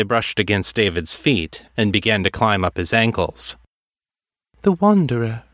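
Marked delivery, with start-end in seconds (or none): 4.6-5.4 high, detached oracle voice; distant. A narrator's reading. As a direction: pitch it high and far away, as if dreaming. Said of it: "in that same distant voice".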